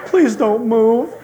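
slow, laughing, mock-timid plea; mock scared plea. Scared rider begging the horse to stay still. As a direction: beg the horse with playful fear. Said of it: "And I went".

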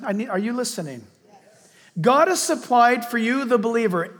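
ordinary delivery

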